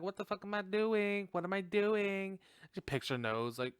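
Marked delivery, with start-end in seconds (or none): 0.0-2.4 high, singsong mock bravado; playfully pitched-up retelling of a dare; mock taunt. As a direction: act out her taunt in a raised voice. Acting out heroine taunting the caller.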